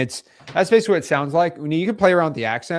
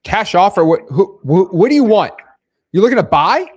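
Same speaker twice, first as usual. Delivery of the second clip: loud, higher, stumbling questions; confused challenge; 4 semitones higher, 11 dB louder than usual. Pretend. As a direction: play a baffled homeowner firing back questions. Plays confused homeowner on test call.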